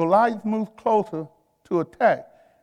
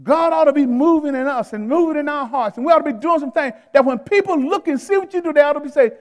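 high, raised preaching voice; forceful preaching; 7 semitones higher, 6 dB louder than usual. Real, not acted. Pastor urges church that God should move in them, forceful preaching. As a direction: press it urgently on the congregation.

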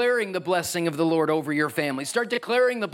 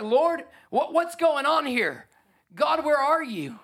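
bewildered cry to God; 8 semitones higher, slower than usual. Retold or imitated. Praying in a crisis, retold.

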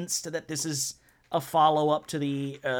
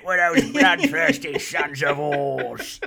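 higher, louder hammed-up medieval yell; shouted medieval line; 4 semitones higher, 6 dB louder than usual. Podcast host quoting the film's over-the-top knight, hammed-up yell. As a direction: ham it up like a shouting movie knight.

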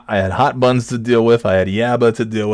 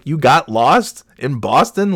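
incredulous; 6 semitones higher, 4 dB louder, slower than usual. Retold or imitated. Incredulous reply to excuse.

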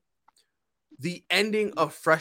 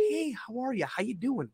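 polite work voice; 8 dB quieter than usual. Retold or imitated. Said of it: "I'm a professional".

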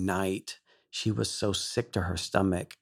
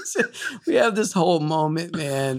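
laughing through the words, higher and louder; 8 semitones higher, 6 dB louder than usual. Real, not acted. Guest recalls the tearful moment of finding Maria, laughing. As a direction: let the joy spill out as a laugh.

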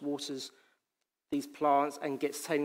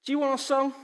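defiant retort; 10 semitones higher, 4 dB louder, faster than usual. Acted. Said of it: "the psalmist turns around and says".

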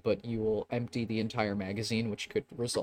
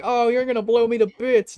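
high, loud cartoon bear wail; hammed-up mock terror; panicked target bear; 12 semitones higher, 11 dB louder than usual. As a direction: cry out like a bear about to be shot. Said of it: "the bear's just like".

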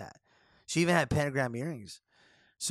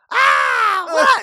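high, loud startled cry; startled yelp; 15 dB louder than usual. Retold or imitated. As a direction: jump out with a shocked squeal. Little person popping out of bag.

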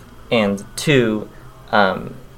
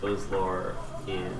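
distant, off-mic voice; far from mic; 11 dB quieter than usual. Real, not acted. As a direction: speak from across the room. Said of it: "I'm gonna step away from the mic".

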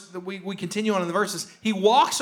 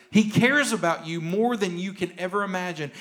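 usual speaking voice

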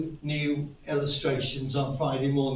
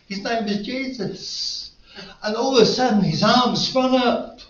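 rising excitement; 7 semitones higher, 6 dB louder than usual. Real, not acted. Climax of testimony, rising excitement.

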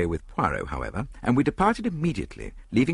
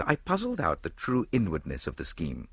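ordinary delivery

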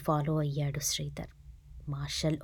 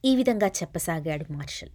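usual speaking voice

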